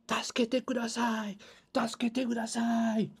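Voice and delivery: high, slow panicked yell of Tasukete kudasai; re-enacted shout for help from the hallway. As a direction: scream for help with everything you have. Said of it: "je gueule de toutes mes forces".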